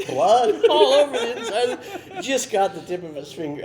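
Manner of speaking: high, loud, laughing delivery; can't stop laughing while you tell it. Real, not acted. Storyteller reaching the punchline for the hosts, laughing hard.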